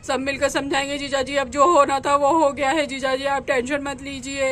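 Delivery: a nervous husband dreading home; worried man. Acted. Husband tells brother-in-law he fears going home, low worried male voice.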